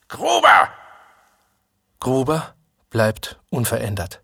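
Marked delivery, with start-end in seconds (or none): 0.1-0.7 high, loud parade-ground yell; haughty shout. A narrator's reading. NCO calling out a cadet in his haughty way, shouting. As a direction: shout the name like an arrogant drill sergeant. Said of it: "schreit der Unteroffizier in seiner hochfahrenden Art".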